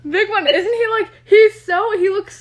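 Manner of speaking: squealing with delight; excited. Real, not acted. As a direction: gush about him. Excited about Matthew Gray Gubler.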